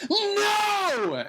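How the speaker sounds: very high, loud scream of horror. Retold or imitated. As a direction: shriek it like the worst just happened. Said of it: "And I screamed out".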